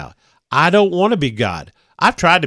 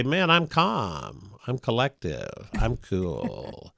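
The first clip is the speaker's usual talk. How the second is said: slow, much softer, settling down; mock calm; 3 semitones lower, 9 dB quieter, much slower than usual. Speaker showing how to talk himself calm. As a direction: lightly act out forced calm. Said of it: "Did you notice he's calm?".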